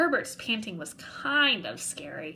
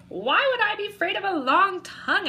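higher, louder incredulous question; 10 semitones higher, 6 dB louder than usual. Acted. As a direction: scoff at the idea. Boy scoffs at monster.

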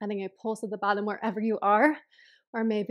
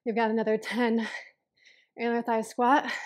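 ordinary talk, nothing marked

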